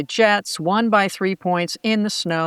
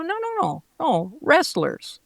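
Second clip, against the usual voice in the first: correcting her; 8 semitones higher, slower than usual. Retold or imitated. Boss explaining which wrestlers to cover, high amused correction. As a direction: set the newbie straight, drawn out. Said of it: "They go".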